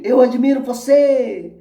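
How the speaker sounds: high, loud answering holler; echoed shout. A narrator's reading. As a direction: call it back as the mountain's echo. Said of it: "A voz responde".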